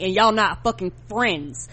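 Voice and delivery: ordinary talk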